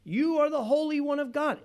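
high, loud proclamation; demon shouting out. Acted. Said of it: "The demon shouts out and he says".

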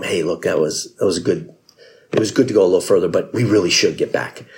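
a low, mild plea to go home. Acted. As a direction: drop the voice low for the weary soldiers. Soldiers pleading with Alexander.

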